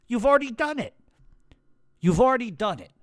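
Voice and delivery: high, loud scornful retort; angry retort. Real, not acted. Ranting at the podcasters who claim innocence, angry and loud. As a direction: fire it back at them, exasperated.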